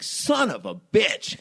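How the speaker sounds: high, slow, drawn-out swearing; retold exasperated curse. Husband looking at wife in tight shirt, exasperated curse. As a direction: stretch out the curse in comic exasperation.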